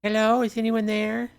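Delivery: high, calling woman's voice; woman outside. Acted. Stranger calling into the shelter, high woman's voice. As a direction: call out high like a stranger at the door. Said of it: "Another woman outside".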